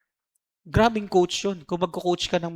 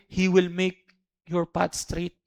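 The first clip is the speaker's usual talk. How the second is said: slow repeat; 4 dB quieter, slower than usual. Shown as a demonstration. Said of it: "slow repeat".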